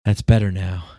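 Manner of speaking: loud, relieved mock sigh of comfort; hammed-up relieved voter; mock relief. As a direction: play a sheep relieved the bad man is gone.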